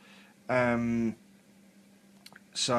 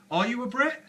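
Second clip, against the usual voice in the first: surprised; 12 semitones higher than usual. Real, not acted. Surprised question to viewer.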